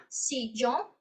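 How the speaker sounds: "sillón" with the ll as a soft y; ya sound. Shown as a demonstration. Teacher showing students the y way. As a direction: say "sillón" as "si-yon". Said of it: "com sonido de ya".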